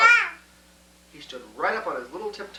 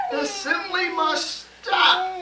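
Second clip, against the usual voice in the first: shouted; 13 semitones higher, 3 dB louder, much slower than usual. A narrator's reading. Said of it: "sat up and shouted".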